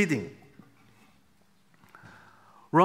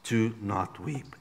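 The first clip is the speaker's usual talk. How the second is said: soft heavenly voice; 8 semitones lower, 10 dB quieter, slower than usual. Retold or imitated. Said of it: "But the voice came".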